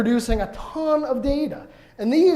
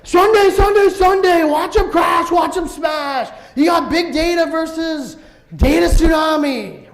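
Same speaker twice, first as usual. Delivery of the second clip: booming high announcer shout, louder; monster truck ad; 6 semitones higher, 6 dB louder, slower than usual. Retold or imitated. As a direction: hype it like a monster truck rally promo. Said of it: "monster truck rally".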